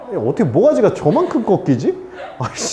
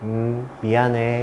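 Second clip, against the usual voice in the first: soft, slow, unbothered sorry; meek apology; 8 semitones lower, 6 dB quieter, slower than usual. Retold or imitated. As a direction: shrug it off quietly, no reaction. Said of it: "이러고 있잖아?". (조용하고 느린 무심한 사과)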